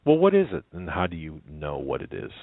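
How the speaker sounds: ordinary delivery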